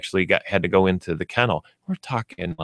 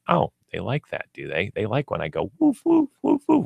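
pleased puppy musing, then high squeaky woofs; a smug little dog figuring it out, then yapping high; the puppy's thoughts; 4 semitones higher than usual. Retold or imitated. Puppy realizing owners love its barking, ending in high yips. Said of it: "and the dog went".

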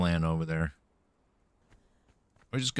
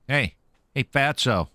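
taunting; 6 semitones higher than usual. Acted. Taunting enemy before fight.

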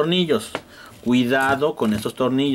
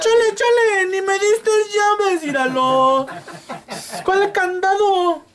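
high, slow fake sobbing whine; mock crying. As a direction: bawl like a helpless customer. Customer whines, no key. (llanto fingido, agudo y lento)

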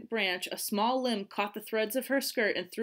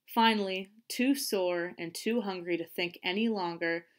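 ordinary delivery

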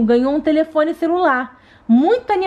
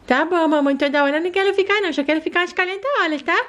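put-on fast, high, joking kid voice; playful daughter teasing mom; cheeky little girl; 4 semitones higher, faster than usual. Doll jokes quickly.

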